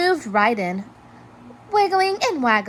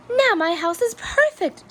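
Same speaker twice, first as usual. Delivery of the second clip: cheering; 12 semitones higher than usual. A narrator's reading. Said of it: "cheered Hermit Crab".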